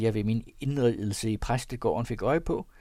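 plain reading voice

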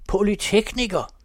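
loud, high-pitched bellow; full-voiced indignant bellow; roar. A narrator's reading. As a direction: roar the single word across the hall. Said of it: "brøler han".

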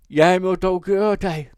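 loud, high-pitched tipsy announcement; drunken proclamation. A narrator's reading. Drunk brother presenting his company, loud and high. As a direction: proclaim it grandly, far above narration level.

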